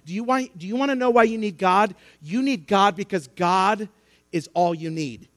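ordinary talk

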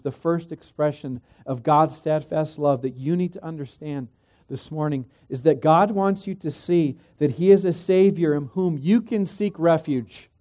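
normal talking voice

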